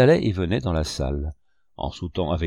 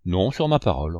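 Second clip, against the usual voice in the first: ordinary delivery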